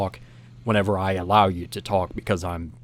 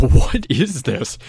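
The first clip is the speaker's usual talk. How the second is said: slow, louder mock bafflement; baffled disbelief; 3 semitones higher, 6 dB louder, slower than usual. The group hearing strange yells, baffled. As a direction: stretch it out in puzzled disbelief.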